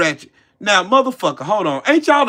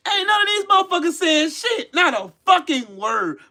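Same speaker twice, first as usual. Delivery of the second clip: angry outburst; 7 semitones higher than usual. Real, not acted. Ranting at critics.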